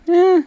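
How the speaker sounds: high, loud dismissive eh. Real, not acted. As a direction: shrug it off with a squeaky eh. Dismissive answer.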